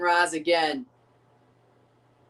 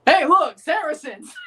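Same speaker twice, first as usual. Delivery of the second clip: higher, louder lookout call; mock crusader cry; 7 semitones higher, 6 dB louder than usual. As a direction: call out the enemy like a knight. Mock crusader spotting enemies.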